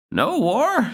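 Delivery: slow, high granny asking; a confused old gran, drawn out; high old grandmother voice. Acted. Grandmother asking Zack about the noise, slow high old-lady voice.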